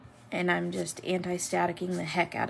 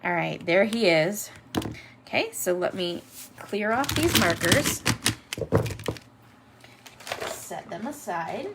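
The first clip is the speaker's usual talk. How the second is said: ordinary talk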